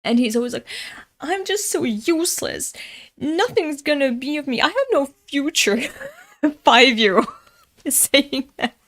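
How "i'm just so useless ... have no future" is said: high-pitched imitation of a self-pitying little boy; mock crying child. Five-year-old wailing to an adult after losing a game, mock despair. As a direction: mildly whine it high, like a despairing kid. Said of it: "he always cries when he loses".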